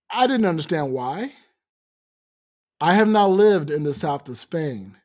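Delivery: usual speaking voice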